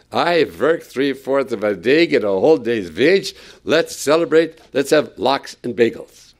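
put-on excited Yiddish-tinged accent, v for w; a gleeful worker bragging in a Yiddish accent; mock Yiddish-flavored worker. Worker bursting in telling Mama, mock Yiddish accent. Nine o'clock worker exults.

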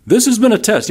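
mock-cheerful broadcast sign-off; happy announcer. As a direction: sound delighted that the test is over. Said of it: "And he's all happy".

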